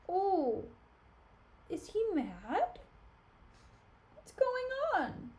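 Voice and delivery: high, drawn-out mock surprise; feigned innocence. Iago pretending he has no idea, hammed-up innocent surprise. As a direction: play dumb, slowly stretching each question upward.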